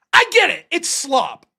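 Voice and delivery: high, loud I get it; exasperated concession. Real, not acted. Conceding wrestling is slop to critics, loud and exasperated. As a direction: throw up your hands and concede.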